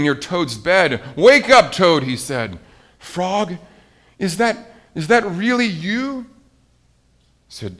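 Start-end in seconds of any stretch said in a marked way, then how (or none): 1.2-2.0 high, loud wake-up call; waking someone up. A narrator's reading. Frog wakes sleeping Toad. 3.0-6.3 high, halting question; hopeful disbelief. A narrator's reading. Toad wakes, finds Frog there.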